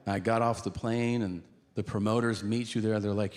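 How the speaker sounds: ordinary talk, nothing marked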